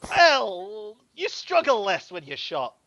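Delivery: thug voice. Acted. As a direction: shrug off the shot. Thug answers player.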